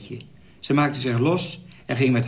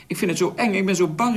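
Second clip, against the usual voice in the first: a touch of fear, lightly raised; girl calling out her fear, understated; mildly frightened cry; 6 semitones higher, faster than usual. A narrator's reading. Frightened girl clinging to her customer, mildly scared call. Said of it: "riep het meisje".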